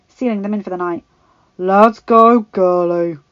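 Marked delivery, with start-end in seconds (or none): 1.6-3.2 loud gruff order; a gruff soldier ordering a girl along; Sandor Clegane, gruff. A narrator's reading. The Hound hoisting Arya onto his shoulder, gruff. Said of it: "Sandor Clegane's gruff voice was recognizable".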